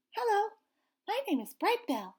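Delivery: a small chick proudly introducing himself; little gosling voice. Acted. Gosling introduces himself to anyone listening, high and eager.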